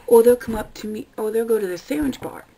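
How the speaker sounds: normal talking voice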